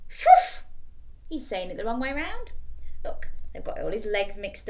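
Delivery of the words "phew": blown loud phew sound; backwards bark phew. Acted. Dog barks backwards, blown phew. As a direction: puff it out like a bark.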